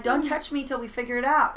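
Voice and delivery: playfully acted marital snap; fiery rebuff. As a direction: push them away with a loud retort. Acted quarrel with husband.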